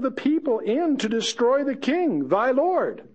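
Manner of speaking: David taunting. Acted. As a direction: needle the general from afar.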